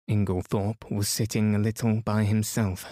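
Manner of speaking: ordinary delivery, nothing marked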